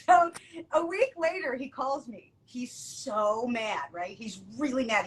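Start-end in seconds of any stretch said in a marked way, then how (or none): none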